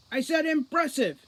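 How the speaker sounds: loud, high irritated repeat; shouted. A narrator's reading. The commander shouting back at Foley over the radio, loud and irritated. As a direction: bark the word at him, fed up. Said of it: "shouted Root".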